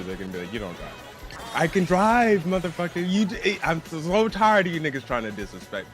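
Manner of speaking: ordinary delivery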